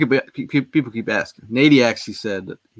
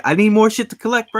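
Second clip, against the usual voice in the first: high-pitched retold complaint; high, put-upon objection; mock protest; 12 semitones higher, 4 dB louder than usual. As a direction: whine it upward in mock protest. Retelling his protest at Prime's urging.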